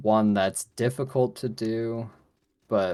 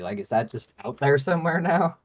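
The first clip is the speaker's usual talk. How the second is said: louder, high-pitched exasperated questioning; indignant; 7 semitones higher, 6 dB louder than usual. Retold or imitated. Patient complaining about lost medical paperwork, indignant. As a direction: complain in raised, incredulous voice.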